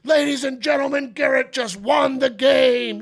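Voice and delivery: loud, high booming stadium announcer. Retold or imitated. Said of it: "I go".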